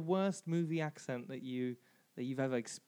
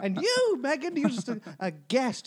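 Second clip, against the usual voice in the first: mock outrage; 6 semitones higher, 5 dB louder than usual. Mock indignant outburst at cohost.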